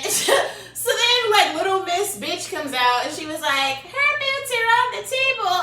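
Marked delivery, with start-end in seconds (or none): 3.8-5.5 high, loud tattletale whine; mocking a worker who tattles to police; mock tattling girl. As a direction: tattle on her like a bratty kid. Little Miss Bitch tattling.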